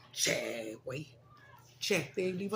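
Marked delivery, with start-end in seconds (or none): 0.1-1.1 "che" said low and slow without "pin", then "güey" rising; dropping the pin. Shown as a demonstration. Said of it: "they don't say pinche something, they go".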